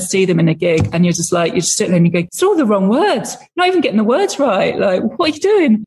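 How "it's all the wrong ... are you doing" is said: higher, exasperated retold complaint; mock annoyance. Director heckling the singer over wrong lyrics, mock annoyed. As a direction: complain like an indignant fan in the audience. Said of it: "It's really annoying".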